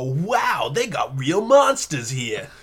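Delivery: amazed, slow, half-shouted wonder; excited Joey voice. Retold or imitated. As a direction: play the awestruck sidekick. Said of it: "besides go".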